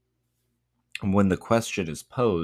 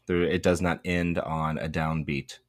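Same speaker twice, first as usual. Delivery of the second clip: normal talking voice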